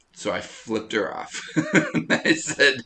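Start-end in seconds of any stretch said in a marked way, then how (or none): 1.4-2.0 sudden high burst of laughter. Real, not acted. Telling cohost the flip-off story, bursting into laughter. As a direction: crack up mid-story.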